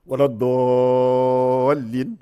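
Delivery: waladdallin with the long a held six counts, slow and loud; six-beat madd. Shown as a demonstration. Teacher showing students the obligatory six-count lengthening, held. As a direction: stretch the aa in waladdallin for six beats. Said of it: "l'allongez de six temps".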